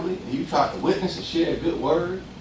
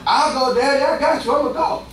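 bright, higher and keen; eager volunteer; 6 semitones higher than usual. Retold or imitated. Son eagerly promising his daddy he'll go work. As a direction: jump at the job like an eager son.

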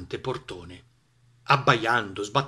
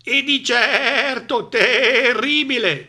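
high, slow, quavering sheep; stammering sheep; 12 semitones higher, 8 dB louder, much slower than usual. A narrator's reading. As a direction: stammer it out trembling. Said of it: "balbettarono le pecore". (pecore che balbettano tremando)

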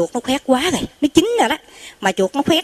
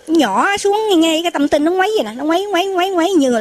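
excited telling; 6 semitones higher than usual. Real, not acted. Preacher excitedly describes light.

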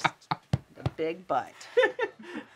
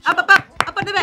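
coaxing the dog; 9 semitones higher, 14 dB louder, much faster than usual. Real, not acted. Coaxing dog onto bed.

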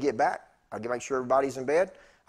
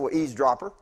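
loud, playful scolding with a laugh; laughing, mock-indignant retort; mock telling-off; 4 semitones higher, 8 dB louder than usual. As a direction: jokingly tell off a nosy buddy. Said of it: "That's what I told him".

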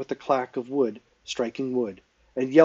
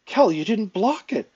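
high, dismayed exclamation; appalled cry; 9 semitones higher, 3 dB louder than usual. A narrator's reading. Faleron to Kel after his blow lands, appalled cry. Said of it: "cried Fa-, Faleron, appalled".

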